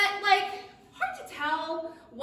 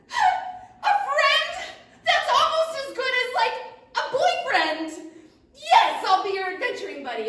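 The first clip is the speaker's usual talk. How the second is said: high and loud squeaky joy; overjoyed dragonfly; 12 semitones higher, 10 dB louder than usual. Acted. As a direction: gush like a tiny thrilled bug.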